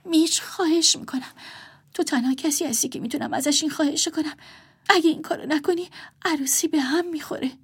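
whispered plea, voice close to crying; near tears. A narrator's reading. Sister begging brother, near tears. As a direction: beg on the edge of tears. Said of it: "اشکش راه بیفتد".

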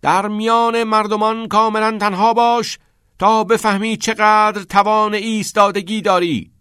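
high, forceful declamation; loud reading. A narrator's reading. Knox reads his line out before kicking the ball, loudly. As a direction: proclaim the verse at full voice. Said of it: "با صدای بلند خواند".

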